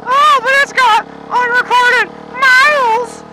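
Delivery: high, slow mocking whine of a fussy buyer; whiny buyer voice. Mocking a future buyer of the bike, high and whiny. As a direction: ham up a nitpicking buyer. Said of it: "is gonna go".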